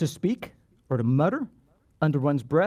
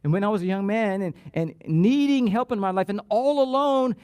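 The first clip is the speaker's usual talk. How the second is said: ordinary talk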